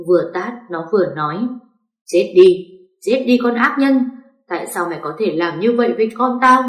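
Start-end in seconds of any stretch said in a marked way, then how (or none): 2.0-2.7 loud shout of die; furious yell. Acted. Hiền slapping Ngọc, a loud furious shout. 3.0-6.6 raging scream. Acted. Hiền slapping Ngọc, a higher enraged scream.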